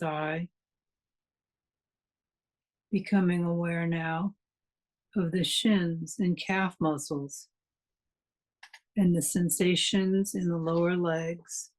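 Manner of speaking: calm meditation guide with long pauses between phrases; guided meditation. Acted. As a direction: guide listeners into relaxation, unhurried, leaving room to breathe.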